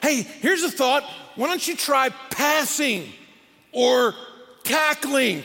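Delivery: high, slow, patronizing suggestion; mock-helpful advice. Onlooker advising a beaten football team, mock-helpful. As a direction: offer the obvious as if a brainwave.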